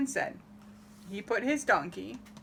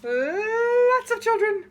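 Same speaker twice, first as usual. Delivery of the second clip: high, loud and slow; excited emphasis; 11 semitones higher, 7 dB louder, slower than usual. A narrator's reading. Reader marvels at the crowd of kids, high and drawn out. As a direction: stretch it out, marvelling at how many. Crowded house, many children.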